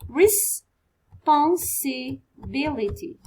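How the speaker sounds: Responsibility said slowly, each syllable apart; teacher sounding out Responsibility slowly; slow syllable read. Shown as a demonstration. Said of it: "responsibility has to be written".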